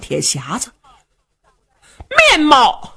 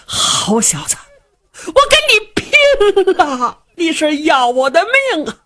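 much higher, dragged-out cry of rage; desperate angry old man; 8 semitones higher than usual. Acted. Enraged old father lunging at his son, desperate cry. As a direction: throw yourself at him in despair. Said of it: "这时候老头也急了".

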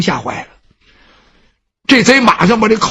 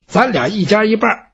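usual speaking voice

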